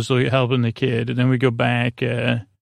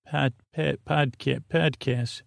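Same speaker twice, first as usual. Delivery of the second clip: soft, halting try at a Syracuse accent; 6 dB quieter than usual. Shown as a demonstration. Host testing how they'd say it in Syracuse, tentative Syracuse accent. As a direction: sound out "podcast" quietly with flat Syracuse vowels. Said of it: "what would I say in Syracuse?".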